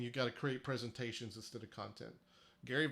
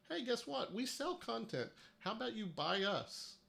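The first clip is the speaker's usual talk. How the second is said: much higher, mocking pitch-man voice; mock salesman; 9 semitones higher than usual. Mocking rival agencies selling more content, high salesy voice. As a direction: ham up a pushy content seller.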